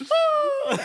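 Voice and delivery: high piercing mock scream; laughing fake scream as she falls. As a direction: shriek it up high for the cliff fall. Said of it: "and then it's like".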